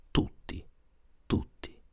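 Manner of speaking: softly, each word left hanging; hushed dread. A narrator's reading. Narrator listing all the child fears, hushed. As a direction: drop to a soft, voiceless tone.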